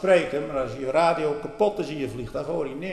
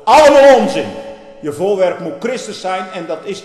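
sudden loud, high cry of contempt; indignant outburst; 5 semitones higher, 13 dB louder than usual. Real, not acted. Preacher scorns an opponent's claim, shouting. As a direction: explode in scorn.

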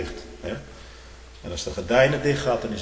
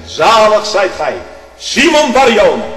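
loud, high, slow proclamation; loud slow blessing; full-force preaching; 12 semitones higher, 16 dB louder than usual. Acted. Preaching climax, repeated later.

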